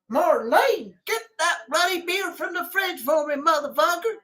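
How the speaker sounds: hammed-up American hillbilly barking for a beer. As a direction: bellow like a beer-swilling hillbilly in his armchair. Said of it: "like the hillbillies sitting in their chair".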